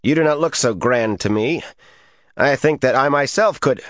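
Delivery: put-on high, accented, boastful scorn; insolent sneer. A narrator's reading. Sneering man challenges Rand to his face, insolently. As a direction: goad him with high-pitched insolence. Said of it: "He looked Rand up and down insolently".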